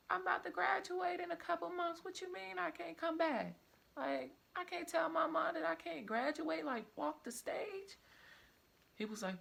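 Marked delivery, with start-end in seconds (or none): 0.1-8.0 high, loud retold desperate protest; desperate pleading. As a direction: beg the principal in rising panic. Pleading not to be expelled.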